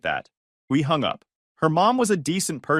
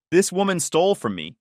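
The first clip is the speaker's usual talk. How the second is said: ordinary delivery, nothing marked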